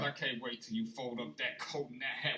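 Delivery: muttered low and soft; under his breath. Retold or imitated. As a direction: grumble it quietly to yourself. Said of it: "He go talking under his breath".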